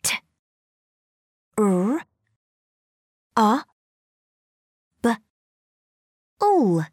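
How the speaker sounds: T, R, A, B, U said as separate sounds; sounding out words. Shown as a demonstration. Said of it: "Blend the sounds".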